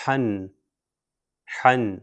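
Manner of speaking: ہن said as "hun" with a nasal n ending; ہن pronounced "hun", tanween ending; tanween sound. Shown as a demonstration. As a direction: say ہن clearly as "hun". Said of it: "اس کو ہم تلفظ کریں گے".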